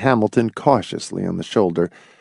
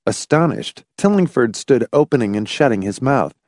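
ordinary delivery, nothing marked

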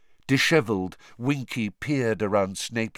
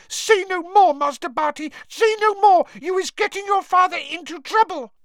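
very high, loud panicked squeal; trembling elf's plea; 6 dB louder than usual. A narrator's reading. Frightened house elf begging master to stop, squealing. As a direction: beg in a frantic tiny squeak. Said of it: "Winky was shaking her head, trembling".

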